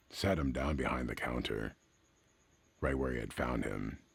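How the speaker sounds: ordinary delivery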